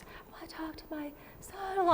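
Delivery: hushed whisper of the mother-in-law's words. Retold or imitated. Medium passing the mother-in-law's message to Dan, whispered. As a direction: breathe it out like a secret from the back seat.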